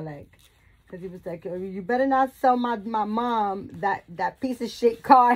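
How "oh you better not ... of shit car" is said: loud retold threat; imitated threatening son. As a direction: imitate an irate son warning someone off, loudly. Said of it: "my son was threatening the man".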